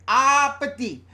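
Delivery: loud, high mimicked toddler babble; imitated little girl's garbled catchphrase; imitating toddler speech. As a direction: call out "Ah, petit" like a toddler with a long open "Ah". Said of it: "she was saying".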